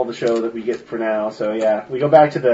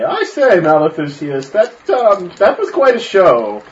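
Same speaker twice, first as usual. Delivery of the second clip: high, loud pompous gentleman; pompous nobleman; 8 semitones higher, 7 dB louder than usual. Acted. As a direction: play a fussy upper-class gent, up high. Noble character addresses showman.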